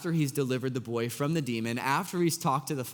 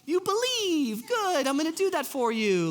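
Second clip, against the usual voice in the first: much higher, louder, cartoonish pleased voice; hammed-up gushing Jesus, mocking a magic-trick faith; 4 dB louder than usual. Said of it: "Jesus is gonna be like".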